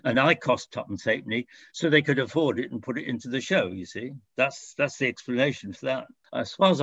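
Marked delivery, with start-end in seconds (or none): none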